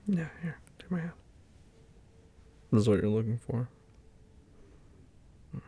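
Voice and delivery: ordinary talk, nothing marked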